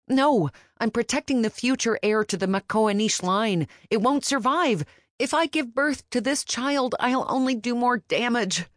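rising, desperate argument; heated. Acted. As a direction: fight back hard for the heir. Heroine argues heatedly.

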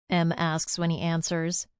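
plain reading voice

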